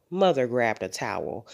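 plain reading voice

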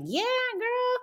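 high, drawn-out encouragement; supportive cheer. Acted. Cheering a kid pointing at the playpen, high supportive voice. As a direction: gush like a hype woman for a toddler. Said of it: "I didn't wanna be supportive and be like".

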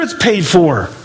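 usual speaking voice

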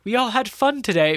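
high, bright-eyed mock upbeat line; cheesy mock cheer. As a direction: parody a corny team-spirit cliché, pitched high. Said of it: "it's gonna sound like such a stupid".

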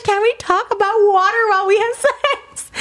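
high, laughing mock reread of the message. Rereading the catfish's text to viewers, laughing. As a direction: read it back through giggles.

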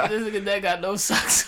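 high, loud, laughing jeer; laughing roast. Real, not acted. Host roasting his friend's outfit, laughing. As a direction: tease him through real laughter.

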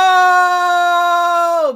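long high drawn-out yell; loud no. Retold or imitated. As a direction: wail a long no. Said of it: "here is where I wrote".

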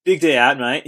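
punchy, loud Australian "mate" talk; Aussie accent, starting loud. Shown as a demonstration. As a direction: lean into a broad Australian accent. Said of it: "say it in the right accent".

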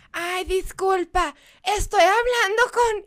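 high, slow put-on caller voice; fake formal voice. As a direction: pretend to be a stranger calling. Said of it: "siempre hago una voz".

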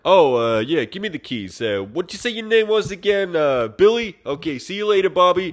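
high, loud, singsong dismissiveness; hammed-up careless sweet talk; mock breezy brush-off. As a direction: act out fobbing off a forgettable admirer. She fobs Billy off for keys.

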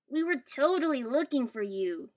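loud, high-pitched accusation; accusing. A narrator's reading. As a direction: call a friend out loudly. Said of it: "he said accusingly".

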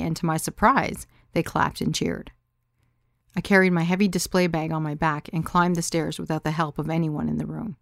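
ordinary delivery, nothing marked

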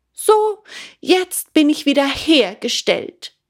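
high, loud and pleased with himself; satisfied puppet. A narrator's reading. Full puppet to father, high and smugly pleased. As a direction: crow it out after a full meal.

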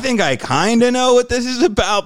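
laughing high and loud through the answer; sheepish laughing. Retold or imitated. Cuffed man tells the cops he kinda knows, laughing. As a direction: giggle nervously while confessing.

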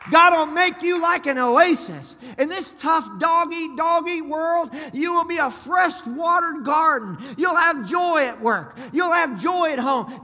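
high, loud, slow shouted preaching; full-force preaching. Acted. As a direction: preach it out to the whole church.

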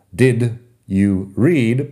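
"read" slow, said like reed with a long ee. Shown as a demonstration. Teacher stresses infinitive "read" after did. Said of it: "usamos did más el infinitivo".

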